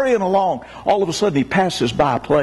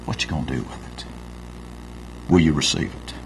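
hushed question; 6 dB quieter, faster than usual. Acted. Repeated, much softer.

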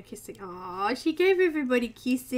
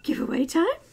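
excited question; 6 semitones higher, 6 dB louder than usual. Acted. Excited repeat.

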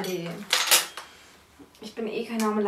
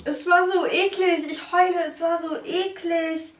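disgusted whine; 6 semitones higher, 6 dB louder than usual. Real, not acted. Reacting to disgusting spoon.

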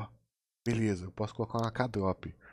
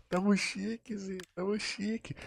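higher, softer giddy squeak; hushed squeal; 9 semitones higher, 6 dB quieter than usual. Pretend. Streamer gloats under his breath, high and soft. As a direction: squeak it quietly with glee.

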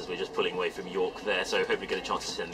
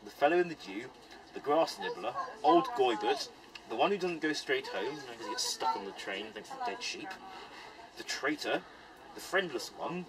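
put-on reciting an old list poem; poem recitation; 4 semitones higher than usual. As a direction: read it like a ballad of names. Reciting old poem of hare names.

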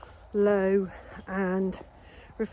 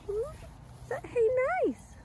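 much higher, slow pet voice; 3 dB quieter, slower than usual. Real, not acted. Owner asking the cat if it's nice, high baby talk.

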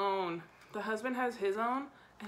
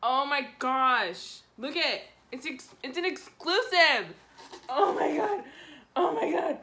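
loud, raised, gushing delight; way too excited; 3 semitones higher, 6 dB louder than usual. Real, not acted. Fan showing off an exclusive hoodie, way too excited. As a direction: gush loudly, overcome with excitement. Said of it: "I'm, like, way too excited about this".

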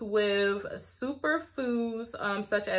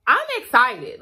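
usual speaking voice

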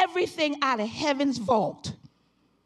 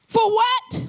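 preaching at full force; 7 semitones higher than usual. Acted. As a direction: preach it out to the congregation. Preaching again.

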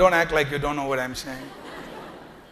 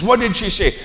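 ordinary talk, nothing marked

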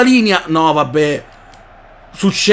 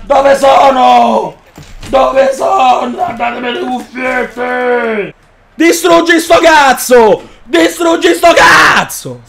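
frantic yelling; slower than usual. Real, not acted. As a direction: shout in a panic.

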